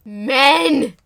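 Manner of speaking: loud, fed-up cry of Men; exasperated shout. Real, not acted. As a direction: yell it in exasperation.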